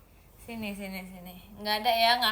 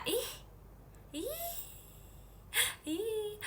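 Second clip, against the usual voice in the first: squeal; 11 semitones higher, 7 dB quieter, much slower than usual. Real, not acted. Called an auntie.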